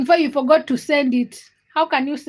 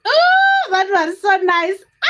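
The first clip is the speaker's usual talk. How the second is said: thrilled gush; 6 semitones higher, 4 dB louder, slower than usual. Real, not acted. Thrilled reaction on livestream.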